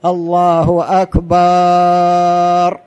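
"أكبر" sung slow with the first vowel stretched, as a wrong example; wrongly drawn-out adhan call. Shown as a demonstration. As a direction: chant "الله أكبر" slowly, stretching the a of "أكبر". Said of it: "هذا لا يصح".

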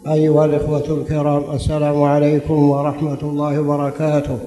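measured formal opening invocation; formal standard arabic. Acted.

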